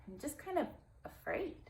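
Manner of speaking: ordinary delivery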